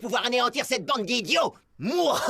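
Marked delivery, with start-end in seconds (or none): 1.8-2.3 gloating laugh. Acted. Villain gloating triumph.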